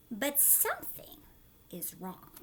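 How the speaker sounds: ordinary delivery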